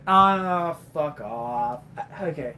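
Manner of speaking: usual speaking voice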